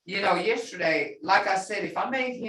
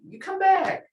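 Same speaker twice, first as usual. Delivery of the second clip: English version; 12 semitones higher, 4 dB louder than usual. Shown as a demonstration. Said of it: "that don't sound exactly like".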